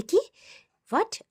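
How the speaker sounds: a sudden high startled what; imitating a stunned cop, pitch jumping up; mock surprise. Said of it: "অবাক হয়ে গেছে পরশুরাম". (হালকা অবাক হওয়ার ভান)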